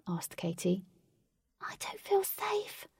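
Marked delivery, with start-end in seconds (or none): 1.6-2.9 high, slow, whispered fear; a tiny fairy hiding in her box; whispered timid fairy voice; frightened fairy. A narrator's reading.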